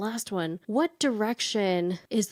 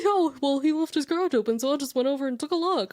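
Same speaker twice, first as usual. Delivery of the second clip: mock innocent voice; 6 semitones higher, faster than usual. Quoting the nosy tenant.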